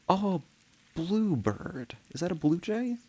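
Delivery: high gasped delight at a bird; light up at a sudden bird; delighted surprise. Real, not acted. Streamer spotting a bird on the feeder cam, high delighted gasp.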